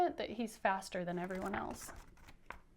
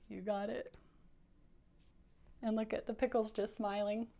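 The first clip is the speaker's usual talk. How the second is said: ordinary delivery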